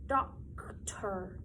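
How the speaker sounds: "Doctor" whispered with a crisp T; whispered word. Shown as a demonstration. Teacher whispering "Doctor" as a hint to the class. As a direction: breathe "Doctor" as a hushed hint. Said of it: "where do you think that T goes?".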